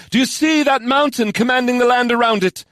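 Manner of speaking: high-pitched rallying question; commanding leader. Acted. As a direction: call it high to the troops. Commander addresses her warriors.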